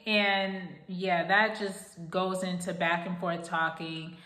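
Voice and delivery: usual speaking voice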